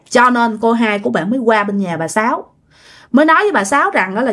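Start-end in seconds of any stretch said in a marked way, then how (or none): none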